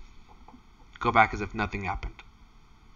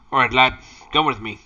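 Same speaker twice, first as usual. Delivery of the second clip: gruff brisk order; 3 semitones higher, 7 dB louder, faster than usual. Acted.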